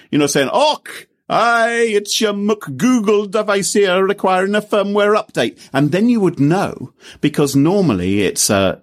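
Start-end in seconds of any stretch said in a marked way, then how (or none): 0.5-5.6 put-on high, bright Scottish accent. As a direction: play a cheerful Scottish device. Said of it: "you could have a Scottish voice".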